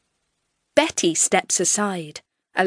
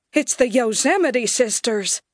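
alarmed cry; 3 semitones higher than usual. A narrator's reading. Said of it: "someone cries out in alarm".

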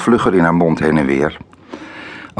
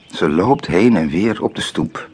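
usual speaking voice

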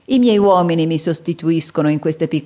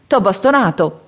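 ordinary delivery

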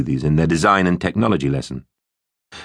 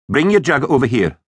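raised, high-pitched instruction; teacher calling; 8 semitones higher than usual. Acted. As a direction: summon pupils over to you. Teacher calls pupils to blender.

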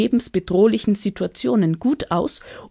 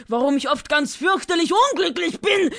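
wailing dragon; 10 semitones higher than usual. Acted. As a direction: wail it like a sulking dragon. Dragon wails about unhappiness. (laut jammernder Drache)

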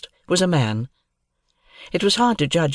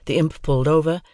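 ordinary delivery, nothing marked

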